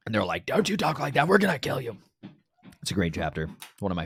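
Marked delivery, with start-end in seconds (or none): 0.5-2.0 high, menacing mock threat from the crowd; a lightly voiced threatening mob; angry mob. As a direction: snarl it like a hostile crowd.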